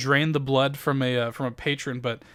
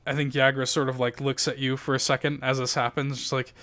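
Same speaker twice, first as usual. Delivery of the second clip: ordinary delivery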